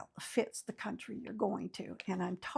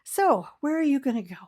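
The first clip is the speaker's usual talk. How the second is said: usual speaking voice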